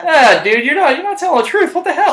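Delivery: mock accusing friend. Calling out a liar.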